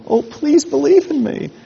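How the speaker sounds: high, whiny mock plea; pleading insecure god. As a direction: play a needy god begging for belief.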